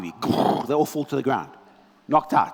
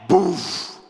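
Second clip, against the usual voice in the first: loud boof; 4 semitones higher, 4 dB louder than usual. Retold or imitated. Sonic boom knocks them flat.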